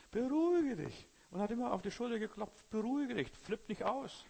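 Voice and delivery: high, loud hammed-up calming; mock soother. Brother soothing excited churchgoers, in a high hammed-up mock voice. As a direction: parody the brother patting shoulders.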